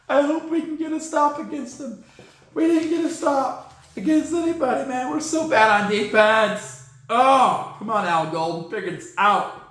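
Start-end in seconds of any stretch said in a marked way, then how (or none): 0.1-6.9 high, strained fan whine; whiny despair. Real, not acted. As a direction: moan it like a fed-up fan. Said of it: "despairing fan whine".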